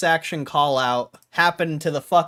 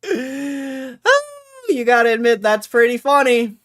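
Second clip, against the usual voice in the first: laughing; 9 semitones higher, 6 dB louder, slower than usual. Real, not acted. Laughing at his own win.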